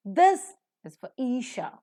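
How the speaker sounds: for said with r before Isha, this lifted; linking r. Shown as a demonstration. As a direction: link the r of for into Isha. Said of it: "R is pronounced".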